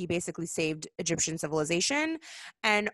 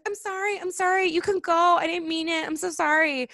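much higher, louder mock grovelling; pleading Pharaoh; 10 semitones higher, 5 dB louder than usual. Pharaoh begging the Israelites to go, mock pleading. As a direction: beg forgiveness in a hammed-up whine. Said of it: "the Pharaoh's like".

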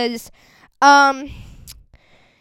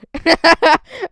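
high giggle; 7 semitones higher, 7 dB louder than usual. Real, not acted. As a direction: laugh up high in the voice. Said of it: "I'm sorry".

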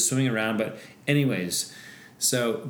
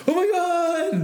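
high, slow horrified oh my God; shocked crowd gasp; slower than usual. Retold or imitated. Crowd on shore watching the sea lion charge, horrified. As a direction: gasp it out, pitched way up and drawn out. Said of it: "Everyone on shore was like".

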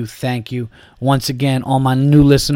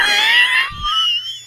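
high laugh breaking out; can't hold the laugh in; stifled giggles. Real, not acted. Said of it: "I'm trying to keep a straight face".

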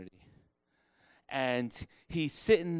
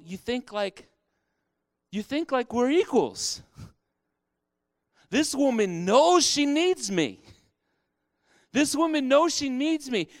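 Jesus rebuking; 12 semitones higher, 7 dB louder than usual. Retold or imitated. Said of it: "Jesus rebukes them and he says".

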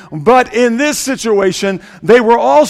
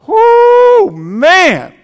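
excited whoop; 12 semitones higher, 7 dB louder than usual. Real, not acted. Preacher exclaims about the bride.

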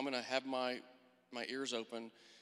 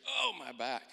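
louder retold yelp of pain; mock pained cry; 5 dB louder, slower than usual. Barber dropping her scissors, mock pained exclamation. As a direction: imitate a sudden twinge of pain.

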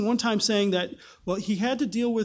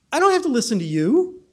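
higher and louder brush-off; dismissive; 5 semitones higher, 5 dB louder than usual. Acted. Festus brushing off the chained Paul, dismissive. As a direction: shut the prisoner down.

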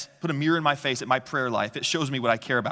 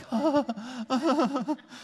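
exasperated laugh; 10 semitones higher than usual. Retold or imitated. Parents after knife incident.

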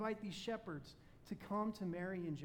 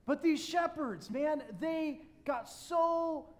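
fervent preaching; 8 semitones higher, 7 dB louder than usual. Acted. Preaches with rising fervor.

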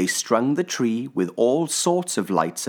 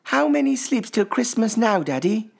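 put-on child voice; 4 dB louder than usual. A narrator's reading. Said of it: "Little Pip asked".

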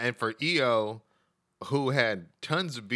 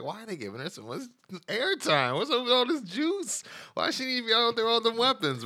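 incredulous rising voice; 9 semitones higher, 3 dB quieter than usual. Retold or imitated. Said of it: "I was like".

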